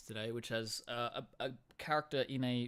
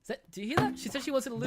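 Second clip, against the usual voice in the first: excited teasing; 12 semitones higher, 9 dB louder, faster than usual. Real, not acted.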